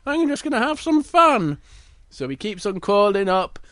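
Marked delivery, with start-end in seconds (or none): 0.0-1.6 high, carefree little bear. Acted. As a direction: brush off the warning in a high cub voice. Little Bear brushes off the warning.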